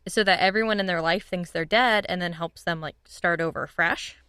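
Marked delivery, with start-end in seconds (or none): none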